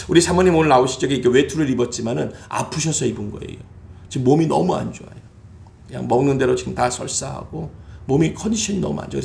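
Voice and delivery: ordinary talk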